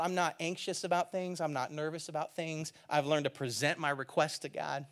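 a calm, untroubled Paul; calm quiet Paul. Retold or imitated. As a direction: say it like nothing worries you. Paul's calm words, voiced quietly.

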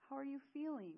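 high, eager question; eager concern. A narrator's reading. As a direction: ask it with rushed worry. Friend hugging after illness.

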